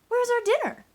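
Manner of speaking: high, loud cat voice; hungry cats calling out; demanding cats. A narrator's reading. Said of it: "asked the cats".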